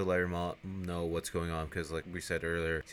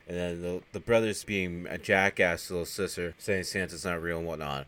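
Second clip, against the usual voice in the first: normal talking voice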